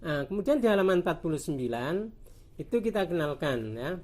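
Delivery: normal talking voice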